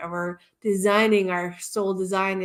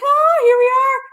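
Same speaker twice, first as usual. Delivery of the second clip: high, loud mock startled exclamation; exclaimed realization; 10 dB louder than usual. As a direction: burst out as if suddenly arriving. Retelling her rushed late start.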